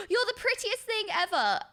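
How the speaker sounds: high, starry-eyed gasp of adoration; gasping gush. Retold or imitated. Streamer imitating herself raving over something pretty. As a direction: gasp then gush, mock overwhelmed. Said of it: "I'm gonna be like".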